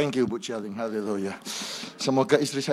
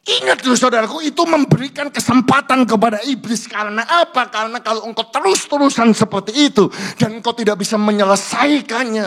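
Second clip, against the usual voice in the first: loud, high fiery sermon voice; preaching at full force; 5 semitones higher, 8 dB louder than usual. Acted. Pastor urging husbands and wives, fiery preaching. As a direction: thunder the warning at the congregation.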